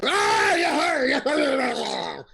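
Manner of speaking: loud high pirate whoop; excited ogre cheer. Acted. Ogres cheering their captain's sword, loud excited whoop. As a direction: roar it like a cheering ogre crew. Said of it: "The ogres go".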